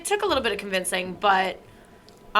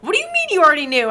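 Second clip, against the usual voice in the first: high, louder affronted question; mock indignant disbelief; 7 semitones higher, 5 dB louder, faster than usual. Retelling friend's shock at old news, mock indignant. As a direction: imitate someone scandalised at being found out.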